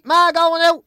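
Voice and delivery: high, loud holler to Ma; parrot mimicking kids. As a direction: shout it like a parrot copying the children. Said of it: "He memorised all the kids' voices".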